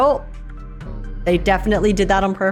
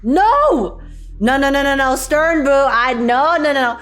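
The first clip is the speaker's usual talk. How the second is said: panicked no; 3 semitones higher than usual. Acted. Panicked refusal to go first.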